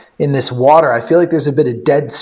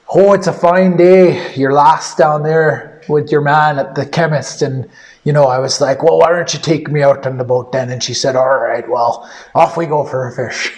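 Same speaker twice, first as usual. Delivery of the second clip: a hammy stage Irish brogue, not very convincing; Irish accent. Pretend. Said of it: "have you told them about my Irish accent".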